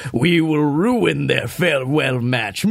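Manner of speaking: slow, menacing villain voice. Retold or imitated. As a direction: growl it out like a cartoon villain. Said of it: "It was villainous as all hell".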